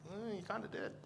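gently dispute it with a doubtful "eh"; sceptical hedging. Retold or imitated. Acts out his doubting reaction.

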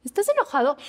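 mock gentle question; a light asking voice; asking an angry person. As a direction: ask it innocently, as if poking. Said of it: "gente que nunca se enoja, que está así".

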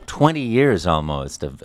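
ordinary talk, nothing marked

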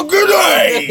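very high, loud Australian holler; mock Australian accent. Co-host joining the accent joke, shrieked mock-Australian greeting. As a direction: shout it as an over-the-top Australian. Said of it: "think that's Australian accent".

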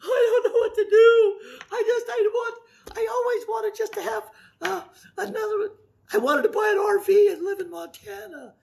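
high-pitched, hammed-up tearful wail; mock scared whining. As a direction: whimper in mock panic, far above your own voice. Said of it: "Now you think you're going".

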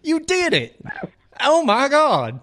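excited. Acted. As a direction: overdo the amazement. Caller guessed the song.

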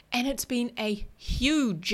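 "huge" stretched out slowly and leaned on; stress on huge. Real, not acted. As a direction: drag out the word "huge" to emphasize it. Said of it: "and I emphasize the word huge".

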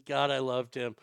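usual speaking voice

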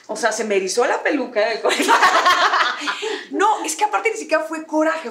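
talking through real laughter; laughing. Real, not acted. Retelling how the dig made her bristle, laughing. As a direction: laugh at your own anger while telling it.

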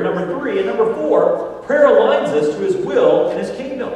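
loud, high, slow preacher's cry; full-force preaching. Acted. Preacher driving home points to his congregation, full-force preaching. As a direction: proclaim each point to the church.

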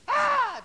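high, very loud imitated wail; mock holler. Preacher imitating daughter at testimony meeting, hollering. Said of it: "she hollered".

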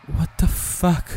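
high, very slow stunned curse; drawn out in horror; shocked. A narrator's reading. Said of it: "הזדעזעתי". (המום ומזועזע, לאט מאוד)

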